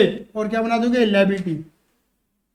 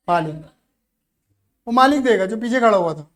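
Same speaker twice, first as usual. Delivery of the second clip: ordinary talk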